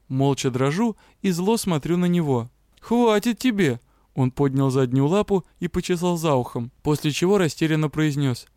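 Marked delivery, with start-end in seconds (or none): 2.8-3.8 annoyed protest. Acted. Dragon protests.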